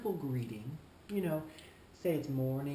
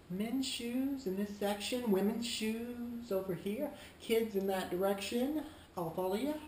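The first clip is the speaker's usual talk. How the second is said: shop clerk directing; 6 semitones higher than usual. Shown as a demonstration. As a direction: point customers to each section in a raised singsong. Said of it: "You could say".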